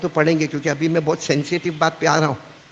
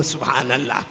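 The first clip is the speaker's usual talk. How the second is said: ordinary talk, nothing marked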